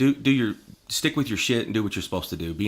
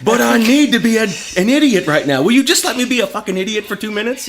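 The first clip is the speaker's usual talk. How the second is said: much higher and louder, laughing mock whine; whiny laughing protest; 13 semitones higher, 7 dB louder than usual. Husband pleading with his zen wife, loud laughing whine. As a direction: beg like a cranky kid.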